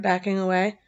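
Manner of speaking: ordinary delivery, nothing marked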